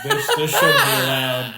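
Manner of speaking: crying with laughter; laughing. Real, not acted. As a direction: say it through laughter. Laughing at joke.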